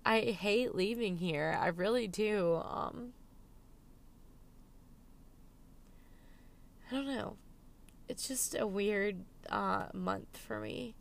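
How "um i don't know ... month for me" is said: holding back tears while talking; emotional, near tears. Real, not acted. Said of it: "sorry I'm being so emotional".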